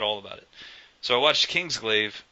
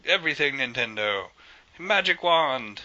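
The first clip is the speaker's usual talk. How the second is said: high, slow, grand Miyamoto decree; Miyamoto impression; 5 semitones higher, slower than usual. To the game team, a slow high mock decree. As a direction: wave the magic wand and pronounce it, slowly. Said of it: "it was like".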